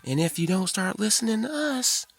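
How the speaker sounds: high, taunting witch cackle into a threat; mocking witch. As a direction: cackle then warn him like a sneering witch. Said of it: "the witches were laughing".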